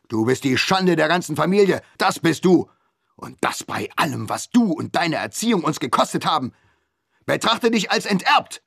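disown her in a rage; furious father. A narrator's reading. Father disowning his daughter in fury.

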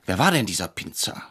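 usual speaking voice

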